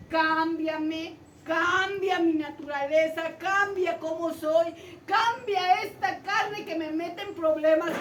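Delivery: begging that builds from speech to loud cries; desperate begging. Retold or imitated. Jacob begging God for a new nature, rising to loud cries.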